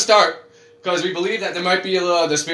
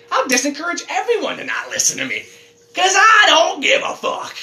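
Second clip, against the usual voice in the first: mock defiance; 11 semitones higher than usual. Mock defiance about losing listeners.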